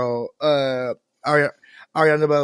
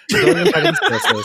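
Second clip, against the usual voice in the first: laughing through the words, pitched up high; 4 dB louder, faster than usual. Real, not acted. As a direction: crack up while scolding the listeners. Laughing at own joke.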